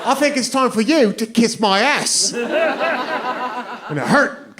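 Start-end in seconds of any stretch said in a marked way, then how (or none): 0.0-2.3 put-on high, cocky drunk British comeback; mock British pub-brawler voice; British accent. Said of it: "cussed at in that accent".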